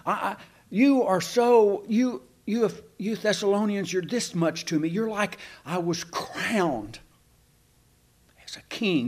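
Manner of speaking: choked-up rush of affection; emotional outpouring. Acted. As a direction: stammer it out as if too moved to speak. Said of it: "this is kind of emotional".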